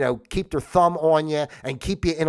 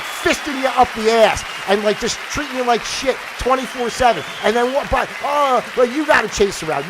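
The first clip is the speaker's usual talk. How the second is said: loud, raised-pitch angry tirade; worked-up rant; 5 semitones higher, 5 dB louder than usual. Real, not acted. Podcaster fed up with the whole system, worked-up rant. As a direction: rant loudly, really fed up. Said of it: "I'm so worked up and upset".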